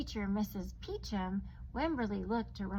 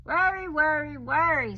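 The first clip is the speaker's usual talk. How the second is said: louder, higher chanting of worry; grandmother voice; 6 semitones higher, 12 dB louder than usual. Acted. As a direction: let grandma tut it three times. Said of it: "said her grandmother".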